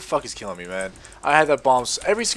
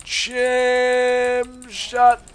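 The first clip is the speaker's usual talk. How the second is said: long, higher, louder stretched call; drawn-out callout; 10 semitones higher, 4 dB louder than usual. Real, not acted. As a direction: hold the word out as you fire. Calling his ability in game.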